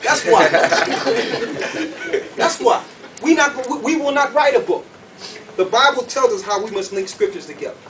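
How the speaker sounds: ordinary talk, nothing marked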